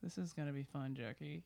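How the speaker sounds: muttered low through clenched teeth; lightly voiced through teeth; through his teeth. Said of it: "said it through his teeth".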